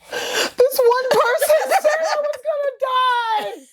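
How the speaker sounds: high, slow, laughing wail of disbelief. Real, not acted. To a friend about a cruel comment, laughing disbelief.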